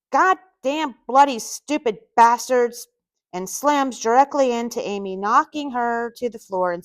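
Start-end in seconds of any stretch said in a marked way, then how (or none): 0.1-2.9 slower, higher and louder angry outburst; pissed-off cursing. A narrator's reading. Scottish man bursts out of a door, cursing angrily. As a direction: curse each word out, pissed. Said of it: "and he's pissed".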